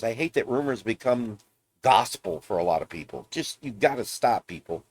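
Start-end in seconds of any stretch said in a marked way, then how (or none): none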